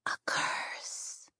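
soft hoarse whisper. Acted. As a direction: breathe it in a whisper. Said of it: "she whispered".

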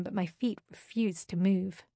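ordinary delivery

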